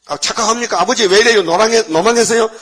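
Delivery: high, loud cry of protest; a son shocked at his father's mistake; alarmed protest. Retold or imitated. (깜짝 놀라 크게 항의하는 요셉)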